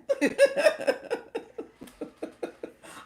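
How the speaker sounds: high, loud real laughing fit; burst of laughter. Real, not acted. Laughs at viewer's correction.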